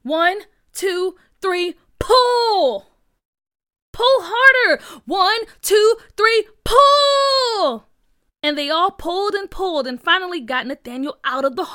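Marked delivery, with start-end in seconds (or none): 0.0-2.9 raised count building to a loud pull; effortful shouted count ending on long PULL; rescue count. Acted. First heave of the rescue. 3.9-4.8 high, loud command; urgent rescue shout; urging yell. Acted. Rescuer urges the heave. 5.0-7.9 high, loud count with screamed PULL; all-out heaving yell; harder rescue count. Acted. Second, harder heave of the rescue.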